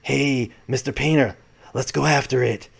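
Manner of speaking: soft-spoken presidential impersonation; Ronald Reagan. Acted. President urging his pilot to chase the object, Reagan impersonation. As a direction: do the old president, quietly. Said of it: "I like doing my Reagan impersonation".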